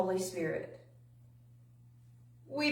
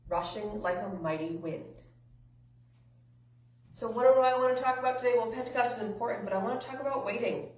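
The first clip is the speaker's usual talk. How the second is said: ordinary talk, nothing marked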